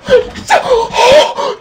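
very high rising gasp of shock; real astonished gasp; huge gasp. Real, not acted. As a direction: gasp in disbelief. Goal just went in.

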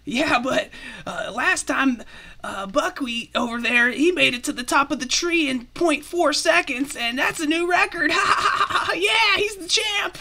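high squeaky excited squirrel; bragging squirrel. Acted. Squirrel bragging about champion Buckwheat, high and giddy. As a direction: boast in a high little voice, breaking into laughter.

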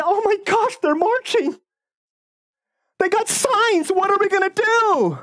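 high, mocking voice of panicked demons; sarcastic mock panic. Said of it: "I'm being sarcastic".

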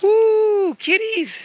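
very high, loud squeal; excited kitty greeting. Real, not acted. A walker spots cats everywhere and squeals very high and loud.

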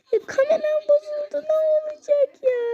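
mock-sob over the broken camera; hammed-up tearful complaint; whiny crying.

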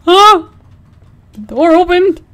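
high, loud frightened shriek; startled scream. Real, not acted. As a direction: yelp in sudden fright. Door suddenly opens in game.

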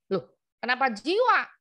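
imitated puzzled, challenging comeback; surprised question. Acted surprise at customer's answer.

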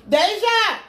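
loud, high call to the next room. Real, not acted. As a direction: shout for her to come. Calling daughter from other room.

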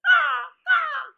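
high loud cawing ah ah; loud cawing crow squawk. A narrator's reading. Crow cawing mid-speech.

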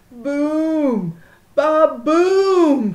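loud thunder boom. A narrator's reading. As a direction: roar out the thunder. Thunder sound voiced in story.

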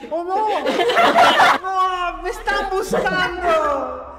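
high, loud hammed-up alarm; mock panic. Teacher pretending the police are after him, mock panic. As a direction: play at being hunted, half laughing.

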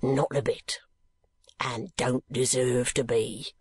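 slow rough-spoken keeper, lightly voiced; a plain working man, unhurried; lightly voiced asylum keeper. Keeper answers.

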